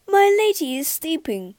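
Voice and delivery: lightly voiced polite poodle; a polite butler dog. A narrator's reading. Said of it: "he said".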